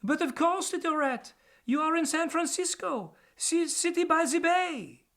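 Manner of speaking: put-on high, lilting French-accented bird. Sparrowhawk answers the little rat in a French accent. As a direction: speak with a beautiful French accent. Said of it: "replied in the most beautiful French accent".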